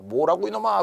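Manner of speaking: high-pitched, lightly acted outrage; mock indignation. Nobleman reacts to the servant's mockery, mock angry. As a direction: demand an answer in half-hearted anger. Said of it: "화를 내겠죠".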